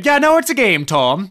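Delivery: high, loud mock protest; mock outburst. Telling off Tom mid-rant, high mock outburst. Listener acts out cutting Tom off.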